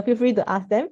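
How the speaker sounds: normal talking voice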